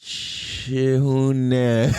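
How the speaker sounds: "Hunan" stretched out with a long sh at the start; slow imitation of a kid's "Hunan"; toddler's mispronunciation. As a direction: drag out "Hunan" as if copying "chips" slowly. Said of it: "And he goes".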